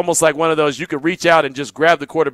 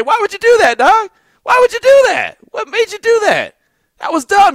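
much higher, louder mock yell, half laughing; incredulous shout; 5 dB louder than usual. To the soaked friend by the pool, an incredulous laughing yell.

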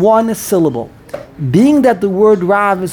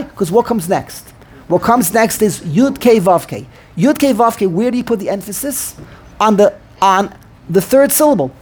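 usual speaking voice

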